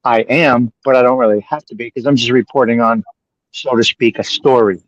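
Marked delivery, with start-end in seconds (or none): none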